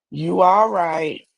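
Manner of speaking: drawn out, slow, unimpressed; slow dismissive. Shown as a demonstration. Showing women how to answer a man, slow and dismissive. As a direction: shrug him off slowly and coolly. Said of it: "Just say".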